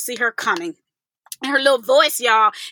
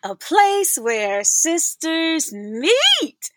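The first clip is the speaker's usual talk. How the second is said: slow, drawn-out show intro line; podcast host opening her show; 5 semitones higher, much slower than usual. Acted. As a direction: announce the podcast name, unhurried. Show opener tagline.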